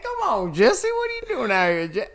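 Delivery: high, loud, put-on voice calling out a name; high mock greeting. Greeting Jesse out of nowhere in a high, loud, put-on voice.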